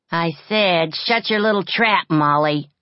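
loud, angry threat. A narrator's reading. Older orphan threatens the crying girl, loud and angry. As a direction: snap it at her, fed up. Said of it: "getting angrily out of bed".

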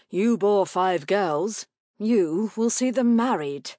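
slow, loud, emphatic orders; stern rebuke. A narrator's reading. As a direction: lay it down slowly and firmly. Husband rebukes wife.

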